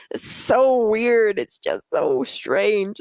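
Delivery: laughing, slowed-down incredulous reaction; amused disbelief. Real, not acted. Host reacting to a crying clip with slow, incredulous laughter. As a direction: let a stunned laugh slow the words.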